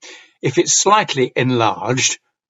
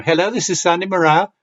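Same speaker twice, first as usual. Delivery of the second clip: higher, quick posh telephone greeting; posh phone voice; 6 semitones higher, faster than usual. Shown as a demonstration. Teacher sending up phone answering, posh telephone voice. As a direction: answer the phone in your poshest voice. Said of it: "a posh phone answering voice".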